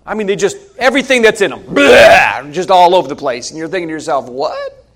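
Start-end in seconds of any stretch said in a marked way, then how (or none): 1.6-2.5 imitated vomit noise; mock throwing up. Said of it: "talking is like throwing up". 4.3-4.9 very high, soft "what"; mock disbelief. Acted startled inner reaction.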